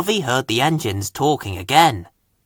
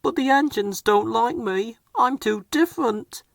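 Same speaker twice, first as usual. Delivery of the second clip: higher, softer downcast voice; sad Harvey; 9 semitones higher, 5 dB quieter than usual. A narrator's reading. Crane engine softly telling his boss, high and downcast. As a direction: quietly confess feeling left out. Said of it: "replied Harvey".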